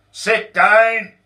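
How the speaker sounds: furious shouted order; yell. Acted. Boss yelling at Donald as he steps inside, shouted. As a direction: bellow it, boss in a rage. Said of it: "yelled Rob O'Glower".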